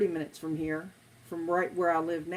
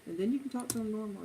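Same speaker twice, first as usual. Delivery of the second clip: usual speaking voice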